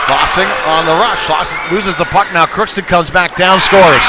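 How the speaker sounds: rising from brisk call to loud goal shout; live call that climbs, then shout scores; play-by-play swelling into a loud goal call; building excited play-by-play. Real, not acted.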